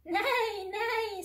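high, drawn-out horse's neigh. Acted. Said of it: "said the horse".

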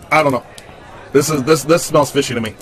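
talking around a cigar; cigar in mouth. Real, not acted. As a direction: lips pressed on cigar. Seen 0: Holds cigar against lips while speaking throughout labelled words.

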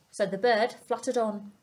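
plain reading voice

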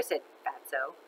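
plain reading voice

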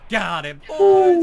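slow, high triumphant yell; excited shout. Real, not acted. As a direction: cheer it out loud and drawn-out. Team wins a fight.

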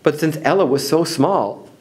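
plain reading voice

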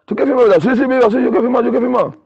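high, eager retold exchange; acted eagerness. Player telling Viva he wants to sign, acted eagerness.

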